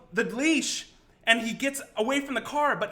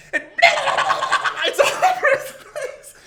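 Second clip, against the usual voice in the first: words squeezed out through high laughter; laughing while telling; 13 semitones higher, 7 dB louder than usual. Real, not acted. Recounting sprinklers soaking the puking boss, laughing. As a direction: try to talk but keep cracking up.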